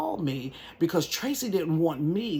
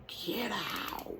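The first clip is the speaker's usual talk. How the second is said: ordinary talk, nothing marked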